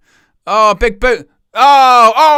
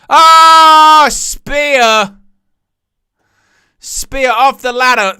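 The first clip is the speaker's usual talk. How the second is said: a sudden loud, high yell of excitement; loud, high burst of hype; excited shout; 6 semitones higher, 7 dB louder, much slower than usual. Real, not acted. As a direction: erupt at the big move. Big spot in live match.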